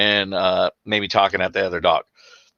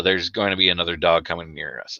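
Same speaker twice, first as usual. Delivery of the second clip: ordinary talk, nothing marked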